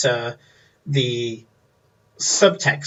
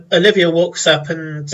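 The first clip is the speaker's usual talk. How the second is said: usual speaking voice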